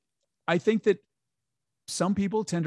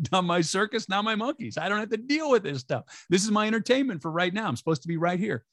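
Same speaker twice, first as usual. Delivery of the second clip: amused aside; 6 semitones higher than usual. Retold or imitated. Her own inner thought, retold.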